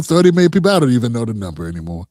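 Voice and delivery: ordinary talk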